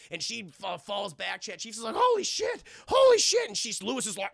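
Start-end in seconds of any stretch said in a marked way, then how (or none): 2.0-3.5 high, shrieked mock alarm; acted panic. Anna reacts to the bloody attack, acted panic. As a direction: blurt it twice in rising panic.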